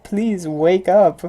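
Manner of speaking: slow, drawn-out pleading; mock plea. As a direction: beg them, dragging it out. Pleading with a loud chewer.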